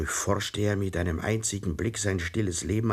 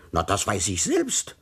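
lightly raised snapping retort; a touch of thundering annoyance; mild mock thunder; 3 semitones higher, 5 dB louder than usual. A narrator's reading. Herr Thomsen snaps back at the inspector, mildly booming. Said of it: "donnerte Herr Thomsen".

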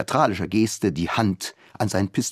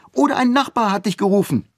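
put-on childish protest; 13 semitones higher, 4 dB louder, faster than usual. A narrator's reading. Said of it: "behauptete in gleichem Ton".

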